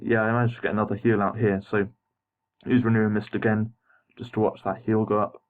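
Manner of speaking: ordinary talk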